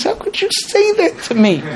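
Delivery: very high, offended mock wife voice; wounded wife. Wife hurt by husband's words, high mock outrage. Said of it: "the wife looks at him and says".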